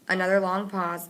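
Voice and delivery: ordinary delivery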